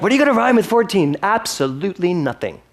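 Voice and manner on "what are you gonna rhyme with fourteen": high, fast, teasing everybody's question; mock asking crowd. Minister mimicking the congregation asking about the rhyme, mock eager. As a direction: toss off the crowd's nagging question. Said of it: "everybody's like".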